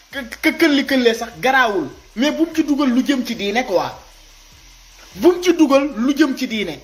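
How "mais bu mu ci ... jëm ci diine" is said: ordinary talk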